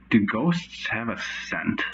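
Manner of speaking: ordinary talk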